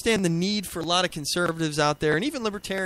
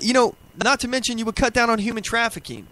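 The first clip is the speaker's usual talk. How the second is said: ordinary delivery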